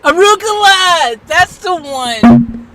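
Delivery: loud, high, drawn-out exclamation; excited recognition. Real, not acted. Streamer gets the word from the assistant, excited. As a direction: shout it out slowly as it comes back to you. Word suddenly recalled, calling it out.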